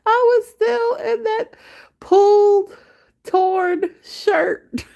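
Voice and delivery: very high, slow, laughing squeal. Real, not acted. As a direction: squeak it out through hard laughter. Laughing hard at the memory.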